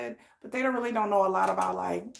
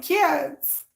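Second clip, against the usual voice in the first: put-on mom calling her kids, pitched up; kid-directed mom voice; 6 semitones higher, 4 dB louder than usual. Shown as a demonstration. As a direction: call out to your kids in mom mode. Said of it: "the way I talk to my kids".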